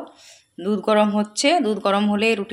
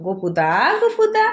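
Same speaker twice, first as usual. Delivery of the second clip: slow coaxing; 8 semitones higher, slower than usual. Real, not acted. Coaxing toddler with cartoon. (ধীরে আদুরে ভুলানো গলা)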